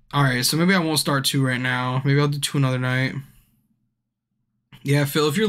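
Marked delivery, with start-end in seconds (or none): none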